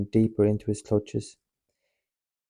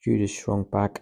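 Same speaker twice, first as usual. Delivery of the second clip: usual speaking voice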